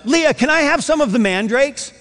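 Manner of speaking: high, lightly voiced asking; light request. Rachel asks Leah for some mandrakes, light high request. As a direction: lift the pitch into a simple request.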